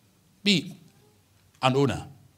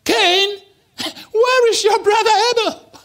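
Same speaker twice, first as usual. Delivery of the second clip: very high, loud booming call; God demanding an answer; God calling Cain; 9 dB louder, slower than usual. Acted. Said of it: "When God came to Cain and said".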